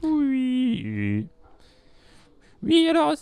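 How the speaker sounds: very high squeaky voice; highest voice. Pretend. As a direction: go up as high as you can. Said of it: "votre voix la plus aiguë".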